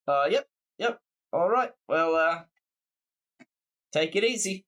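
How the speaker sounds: put-on relaxed Kiwi sign-off, New Zealand accent. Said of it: "so it just became a New Zealand accent".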